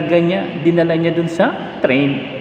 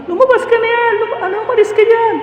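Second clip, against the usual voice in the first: very high, fast mock yell; crowd yelling; faster than usual. Bystanders yelling at a boy on the tracks, high mock yell. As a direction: call out like frantic bystanders. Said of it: "nagsisigawan na".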